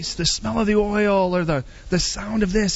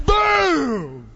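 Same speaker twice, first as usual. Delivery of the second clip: shouted boom; 5 semitones higher than usual. Retold or imitated.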